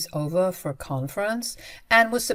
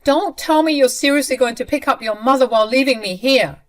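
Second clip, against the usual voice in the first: loud, higher angry demand; furious; 6 semitones higher, 7 dB louder than usual. Retold or imitated. Stranded wife angrily confronting husband on the phone, loud. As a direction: confront him hard. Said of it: "I was furious".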